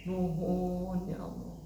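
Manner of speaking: a mild, hushed imitation of a weeping pilgrim; retelling a pilgrim's sobbing thanks, lightly acted; imitated tearful prayer. Preacher imitating a pilgrim crying out at Arafah, mild tearful plea. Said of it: "Ada yang sampai keras kali suaranya".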